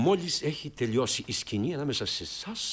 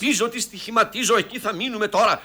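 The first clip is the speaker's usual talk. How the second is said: high, raised-voice dismissal; impatient outburst; 8 semitones higher than usual. Acted. Director ends a squabble over one word, impatient. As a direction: cut the squabble short, pitch up.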